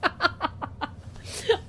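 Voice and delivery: high breathless giggle. Real, not acted.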